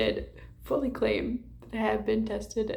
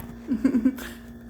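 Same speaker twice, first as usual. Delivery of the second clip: short giggle; 3 semitones higher than usual. Real, not acted. Laughs after greeting someone.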